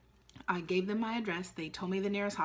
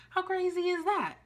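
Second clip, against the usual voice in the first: much higher, louder exclamation; amazed; 11 semitones higher, 4 dB louder than usual. Real, not acted. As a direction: toss it out in sheer astonishment. Marvelling at unknown pregnancy.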